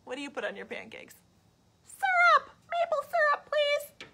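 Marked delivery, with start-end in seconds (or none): 1.8-4.1 very high, loud squeaky pig demanding syrup; pig character. Acted. The pig begging the child for maple syrup, squealing high. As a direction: squeal it like an eager piglet.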